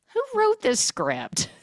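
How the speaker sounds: very high, drawn-out disbelief; incredulous outburst. Real, not acted. Podcaster reacting to the boner line, incredulous. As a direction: squeal the question in exasperation. Outburst at silly movie dialogue.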